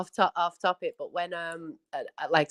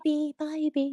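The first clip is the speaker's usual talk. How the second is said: mimicking [name]; 7 semitones higher, 4 dB quieter than usual. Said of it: "[name]".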